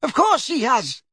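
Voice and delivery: put-on old lady impatiently correcting the boy. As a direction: scold him for asking. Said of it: "said Missus Figg impatiently".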